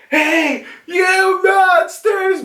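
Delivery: high, drawn-out holler at the crew; imitated indignant shout from afar; high-pitched yell. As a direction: call out in a squealing, hammed-up yell. Momonosuke arrives, calls out.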